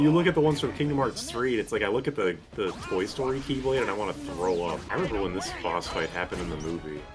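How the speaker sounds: ordinary talk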